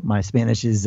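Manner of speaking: ordinary talk, nothing marked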